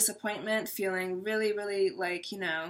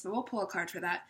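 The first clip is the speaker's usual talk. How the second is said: ordinary talk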